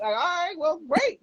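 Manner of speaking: excited. Retold or imitated. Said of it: "I'm like".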